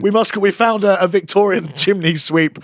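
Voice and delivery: usual speaking voice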